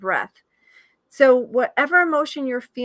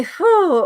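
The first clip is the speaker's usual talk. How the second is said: relieved whew; 7 semitones higher, 8 dB louder than usual. Real, not acted. Exhale during the breathing exercise.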